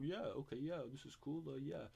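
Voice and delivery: exaggerated gushing endorsement, high-pitched; Joe Rogan. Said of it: "and Joe".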